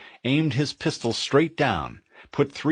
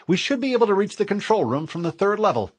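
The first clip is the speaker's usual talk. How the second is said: female AI voice; 11 semitones higher, faster than usual. Acted. Cortana voiced, higher, quicker.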